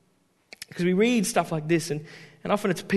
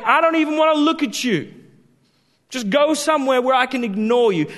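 higher, louder dismissive Jesus; harsh dismissal; 6 semitones higher, 6 dB louder than usual. Acted. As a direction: push him away with contempt. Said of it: "He's saying".

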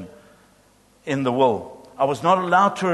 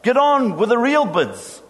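high, raised and indignant; angry shout; 6 semitones higher than usual. A narrator's reading. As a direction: demand it, fed up with the wait. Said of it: "Another voice shouted angrily".

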